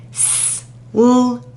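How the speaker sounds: s, l, ap said apart; segmented sounds. Shown as a demonstration. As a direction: stretch out each sound. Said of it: "Your turn".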